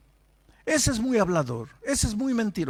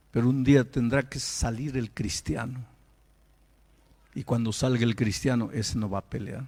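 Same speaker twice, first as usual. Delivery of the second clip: low reflective preaching; 7 semitones lower than usual. Acted. Quieter, lower preaching stretch.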